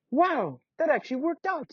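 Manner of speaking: put-on high, wide-eyed kid; a little boy impressed; Timothy reacts to the blacksmith's name; Timothy amazed. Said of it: "said Timothy".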